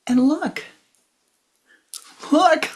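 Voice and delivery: high, rising, pleading voice to the guru; distraught disciple begging for help; emotional plea. Retold or imitated. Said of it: "as I'm highly emotional".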